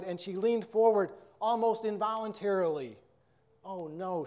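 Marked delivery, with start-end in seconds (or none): 3.6-4.2 softer, stunned little gasp; a mild shocked gasp of disbelief. A narrator's reading. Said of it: "she gasped".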